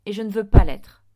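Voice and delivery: very loud, vehement refusal; insistent. A narrator's reading. Boy refusing to join the teachers, loudly insistent. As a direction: insist at a near shout. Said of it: "insista David".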